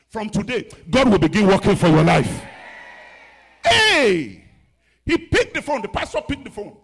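loud declaration, high; preaching at full force. Acted. Pastor declaring a blessing on the church. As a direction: proclaim it over them.

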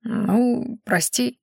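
sheepishly drawn out apology; guilty drawl. A narrator's reading. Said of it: "виновато протянул Шедай".

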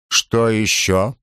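slow, icy demand; a slow cold question from the emperor. A narrator's reading. Cold general presses messenger.

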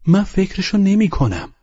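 loud, high protest; cutting in. A narrator's reading. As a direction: jump in over her words. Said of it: "میان حرفش دوید".

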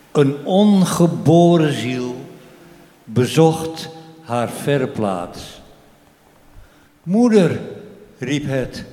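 measured poetry reading with voiced dialogue; poem recital. Poet reads his poem about an unborn soul to the audience, measured recital.

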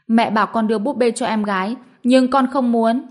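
put-on pouty child. A narrator's reading. Little girl refusing to give her sister the doll, pouting. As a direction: pout and refuse loudly. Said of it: "Hạnh Hân bĩu môi nói".

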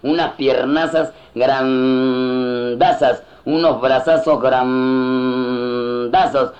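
drawn-out bigness. Acted. Comic describing giant Inca limbs, drawn-out exaggeration. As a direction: stretch the big words out very long.

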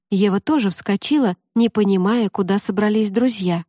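usual speaking voice

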